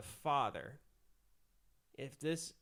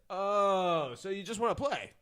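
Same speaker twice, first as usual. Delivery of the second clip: mock predator; 4 semitones higher, 7 dB louder than usual. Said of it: "been like".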